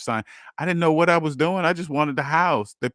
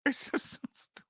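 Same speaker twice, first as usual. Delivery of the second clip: laughing; 10 semitones higher, 12 dB quieter than usual. Real, not acted. Laughing at own past.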